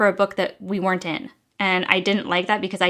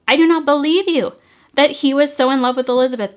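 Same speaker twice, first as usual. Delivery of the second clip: I do not believe you, pitched higher and louder; louder, higher disbelief; 6 semitones higher, 6 dB louder than usual. Real, not acted. Reviewer ranting at the author, louder exasperated disbelief. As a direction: push it up, exasperated. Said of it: "repeated with rising force".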